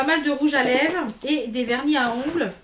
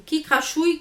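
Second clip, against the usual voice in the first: ordinary delivery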